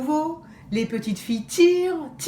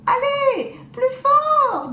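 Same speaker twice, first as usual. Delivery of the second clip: high, louder urging wolf; 5 dB louder, slower than usual. Acted. The wolf urges the girls to pull harder, impatient. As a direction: push them to pull harder. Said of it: "dit le loup".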